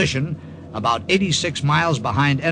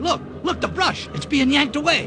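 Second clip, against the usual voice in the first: much higher, urgent cry of discovery; excited alarm; 13 semitones higher than usual. Acted. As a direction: point it out suddenly to your pilot. Spots movement in the field.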